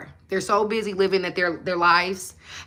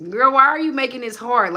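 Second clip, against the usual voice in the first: louder, higher, sassy disbelief; exasperated girl; 5 semitones higher, 5 dB louder than usual. Retold or imitated. Telling viewers who overcomplicate it, exasperated. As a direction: call her out, exasperated. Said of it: "And I'm like".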